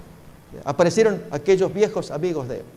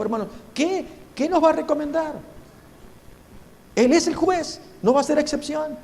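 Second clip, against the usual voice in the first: fervent preaching; 5 semitones higher than usual. Real, not acted. A preacher warns the brothers about the coming judgment, fervent preaching. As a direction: press the warning on the congregation.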